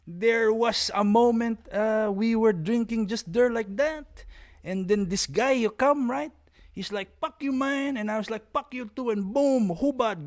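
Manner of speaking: high, slow, swaggering storyteller imitation; hammed-up old master bragging about a street fight; imitating an old master. Mocking a grandmaster telling his fight story, high and slow. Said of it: "is 'cause, well".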